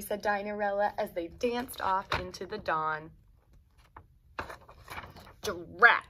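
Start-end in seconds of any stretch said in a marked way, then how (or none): 5.4-6.0 loud drat. Acted. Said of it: "said Dora and Doris".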